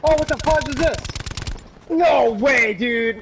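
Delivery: high, loud disbelieving yell; shocked outburst. Real, not acted. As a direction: caught off guard, shouting. Suddenly killed in game.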